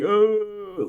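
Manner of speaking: very high mock-panicked "ooh"; imitated freak-out moan. Brother freaking out over the news, imitated high wail. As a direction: draw out a high wail of pretend alarm. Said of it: "he was freaking out".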